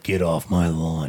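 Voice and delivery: put-on low, gruff old man; grumpy old man voice. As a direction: shoo kids off the lawn. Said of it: "Grumpy old man".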